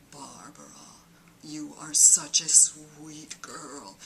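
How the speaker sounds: echoey divine whisper to herself; her God's voice. Pretend. Comedian voicing her own imposter God to Barbara. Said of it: "I just sounded just like my God".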